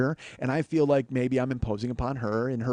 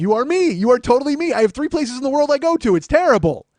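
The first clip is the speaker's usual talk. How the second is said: higher and louder, "You are me" insisted; excited exclaim; 9 semitones higher, 9 dB louder than usual. Retold or imitated. Host retelling how he shouted to Lily at lunch. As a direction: exclaim it to her, half laughing.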